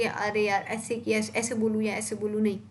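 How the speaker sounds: mock-hesitant, second-guessing each phrase. A student unsure how to speak, lightly acted hesitation. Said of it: "डर डर के बोल रहे हो या आपको कुछ संकोच है".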